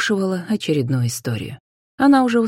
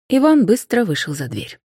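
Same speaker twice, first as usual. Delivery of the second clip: usual speaking voice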